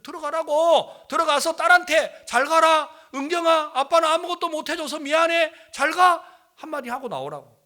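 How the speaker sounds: loud, high, insistent outburst; retold shouted urging. Preacher pushing father to see dying daughter, shouted insistence. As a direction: yell the farewell words at him, easing at the end. Repeated plea, now shouted in exasperation.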